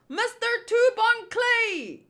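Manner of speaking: high, projected call to the captain. Acted. Crew hailing their captain, high calling voice. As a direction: sing out the name across the ship.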